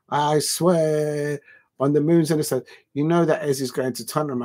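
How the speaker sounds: ordinary delivery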